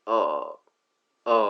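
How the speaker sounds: আ held out as a long vowel. Shown as a demonstration. Teacher showing the long sound, drawn-out আ. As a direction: stretch the schwa-like আ. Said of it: "এই long সাউন্ডটা হচ্ছে".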